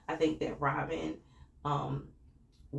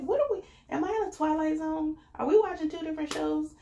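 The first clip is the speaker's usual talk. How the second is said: much higher, louder disbelief; incredulous; 12 semitones higher, 6 dB louder than usual. Retold or imitated. Vlogger reacting to viewers' take, much higher incredulous outburst. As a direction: squeal it like you can't believe it. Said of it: "I'm like".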